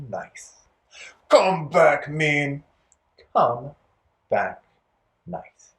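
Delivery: mean and nice voices. Shown as a demonstration. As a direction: bark "mean", breathe "nice". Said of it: "one more version".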